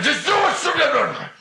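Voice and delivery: high, loud frantic yell; screamed order. Acted. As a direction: bark the order, out of patience. Screams at friend to shoot.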